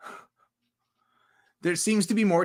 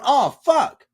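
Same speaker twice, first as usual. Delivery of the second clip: higher, louder imitated outburst; mock exclamation; 9 semitones higher, 5 dB louder than usual. City people reacting to the country, high mock exclamation. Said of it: "Everybody in the city is going".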